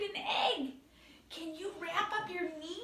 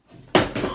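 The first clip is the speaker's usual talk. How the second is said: loud gasp; 12 dB louder than usual. Acted. Host gasps at moving box.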